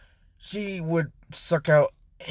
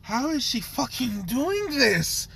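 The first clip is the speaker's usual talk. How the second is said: awed disbelief; 9 semitones higher, slower than usual. Acted. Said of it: "he's just like".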